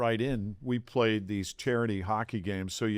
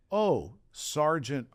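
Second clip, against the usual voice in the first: slow accusing report; slower than usual. Retold or imitated. Wife quotes police phone call.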